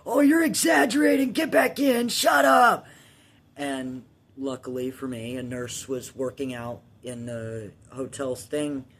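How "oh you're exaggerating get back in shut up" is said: high, loud dismissive shout; mocking brother. Older brother in the shallow end, high mocking shout. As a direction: yell it like a scornful big brother. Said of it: "sitting there going".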